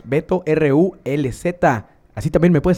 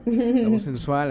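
voz sensual; 7 semitones higher, much slower than usual. Shown as a demonstration. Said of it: "con la voz sensual".

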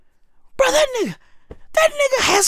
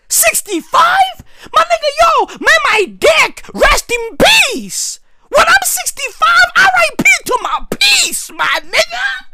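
mock despairing yell; 7 semitones higher than usual. Real, not acted. Mourning his own future at sixty-five, mock yell. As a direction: yell it like the world ended.

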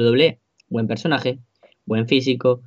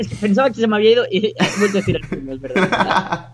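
laughing while talking; 6 semitones higher than usual. Real, not acted. Laughs at own mistake.

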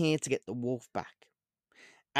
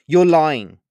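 loud, raised-pitch accusation; angry; 4 semitones higher, 12 dB louder than usual. A narrator's reading. Olivia accuses the witch of lying, angry. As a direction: burst out with an angry charge. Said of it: "said Olivia angrily".

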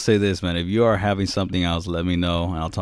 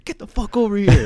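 high, louder mock call across the room; mock shout; 11 semitones higher, 4 dB louder than usual. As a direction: imitate a friend yelling you over, half-joking. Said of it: "then you're over here".

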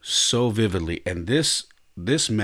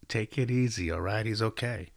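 soft reassurance; 9 dB quieter than usual. Retold or imitated. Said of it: "he goes".

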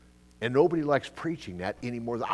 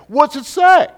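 high, loud demanding question; exasperated shout; 16 dB louder, slower than usual. Real, not acted. Preacher challenging the congregation, shouting loud. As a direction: fire the question at them.

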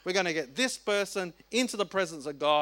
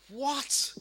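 surprised; 5 semitones higher, 4 dB quieter than usual. Real, not acted. Astonished reaction to verse.

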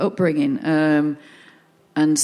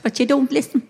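nun telling off; 5 semitones higher than usual. Retold or imitated. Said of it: "they used to describe me as".

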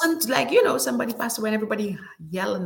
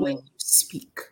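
whisper; 7 semitones lower, 4 dB quieter than usual. Real, not acted. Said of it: "whispered aside".

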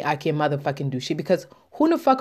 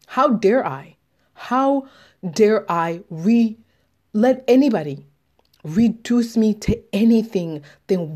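rising fury; 2 semitones higher, slower than usual. Real, not acted. Ranting to herself about being reduced, climbing in fury. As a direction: build a furious rant, pushing up in pitch.

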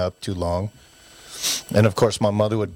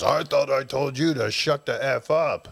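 a hint of a scolding brute of a father, higher; lightly hammed-up parent barking at the kids; mock angry parent; 5 semitones higher than usual. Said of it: "the Neanderthal parent comes in and says".

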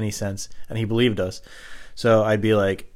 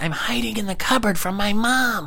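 put-on much higher, childish prank-caller voice; John's kid voice; 12 semitones higher than usual. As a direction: play a twelve-year-old boy on the phone, pitched way up. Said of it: "this twelve-year-old kid, John".

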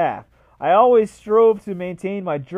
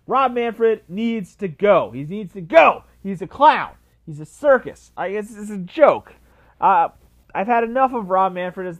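angry rant; 3 semitones higher, 6 dB louder than usual. Real, not acted. Fed-up fan ranting to viewers about Manfred. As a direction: hammer each short line home in disgust.

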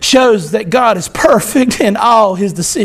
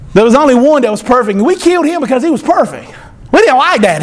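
normal talking voice

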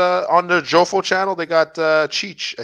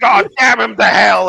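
loud hammed-up outburst; mock furious announcer; 5 semitones higher, 8 dB louder than usual. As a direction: curse him out like a ringside caller. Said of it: "it's like".

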